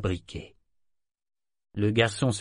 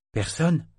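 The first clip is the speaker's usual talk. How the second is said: cold question; 6 semitones higher than usual. Acted. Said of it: "demanda-t-elle froidement".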